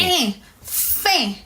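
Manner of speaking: "Eh", "Fin" through the nose; "Fin" as nasal "feh", no hard n; nasal vowel. Shown as a demonstration. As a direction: isolate the nasal "Eh", then add the f. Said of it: "It's more of a nasal sound".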